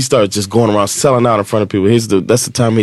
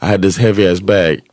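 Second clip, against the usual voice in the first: ordinary talk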